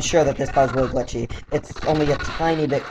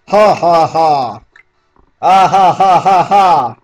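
loud, drawn-out ha-ha laugh; slow, booming stage laughter; hammed-up laugh; 2 semitones higher, 7 dB louder, slower than usual. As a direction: belt out each fake ha. Voices characters' hearty laughter.

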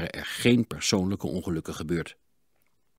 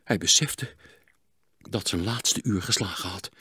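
ordinary delivery

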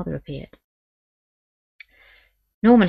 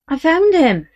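put-on boy's voice; 10 semitones higher, 7 dB louder, slower than usual. Boy voiced in story.

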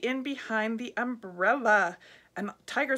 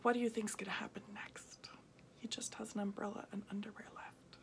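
whispered aside; 2 semitones lower, 12 dB quieter than usual. Real, not acted. Reader whispering to the listening kids, hushed. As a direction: lean in and whisper. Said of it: "whispered aside to listeners".

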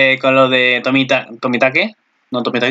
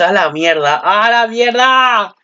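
exasperated cry; 6 semitones higher, slower than usual. Retold or imitated. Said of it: "Yo dije".

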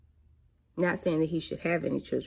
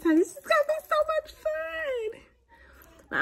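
high gushing; much faster than usual. Real, not acted. Gushing about being an aunt.

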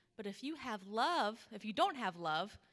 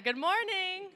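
put-on a bigger, louder hello to show the kids; louder good morning; 9 semitones higher, 8 dB louder than usual. Shown as a demonstration. Pastor modelling a louder greeting for the kids. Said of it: "the big lambs last Sunday did better than that".

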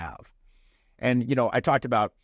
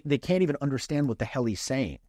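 normal talking voice

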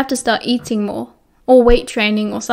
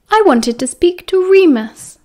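put-on higher, louder girlish voice, slow; Lily pointedly speaking past James; Lily's voice; 7 semitones higher, 5 dB louder than usual. A narrator's reading. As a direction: speak up as the girl, firm and bright.

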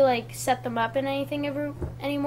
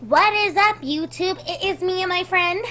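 higher, squeaky kid voice imitation; mock squeaky kid voice; 5 semitones higher, 3 dB louder than usual. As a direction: mimic your squeaky younger self. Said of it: "I mean, it's pretty fucking squeaky".

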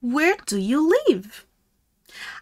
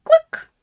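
meerkat cluck; 5 dB louder than usual. Pretend. Mimicking meerkat sounds.

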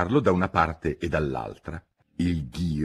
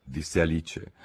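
plain reading voice

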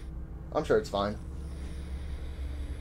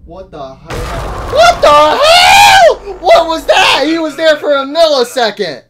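high, loud, slow drawn-out yells; shocked yelling; 7 semitones higher, 10 dB louder, slower than usual. Real, not acted. Streamer yelling after a sudden attack. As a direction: shout in real shock. Foxy jump-scares him.

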